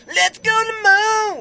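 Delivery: high, slow ad shout; commercial jingle voice. Retold or imitated. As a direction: sing out like a cheesy ad. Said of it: "that's what the commercial sounded like".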